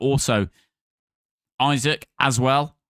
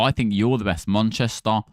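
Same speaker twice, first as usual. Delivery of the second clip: ordinary delivery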